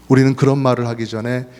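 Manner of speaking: usual speaking voice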